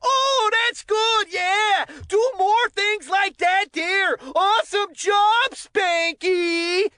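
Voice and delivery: high, slow cheering yell at the umpire, sarcastic; mock yelling from the stands. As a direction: heckle the ump like a kid in the bleachers, half-joking. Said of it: "telling off the umpires by yelling".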